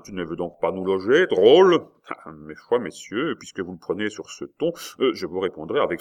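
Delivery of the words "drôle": loud, high contemptuous jab; sneering at an insolent innkeeper; scornful insult. A narrator's reading. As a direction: spit the word at him.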